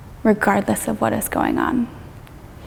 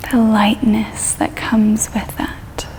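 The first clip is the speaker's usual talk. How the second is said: guided meditation. Real, not acted. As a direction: ease them gently through it, drawing out the phrases.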